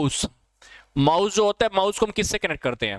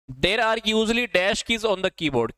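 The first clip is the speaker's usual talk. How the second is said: slow dictation; 3 semitones higher, much slower than usual. Shown as a demonstration. Reading a quiz question aloud.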